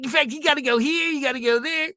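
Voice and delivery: high, shouted gushing voice; excited Ralph. Retold or imitated. Coworker hearing about Disneyland trip, high excited gushing. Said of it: "he's like".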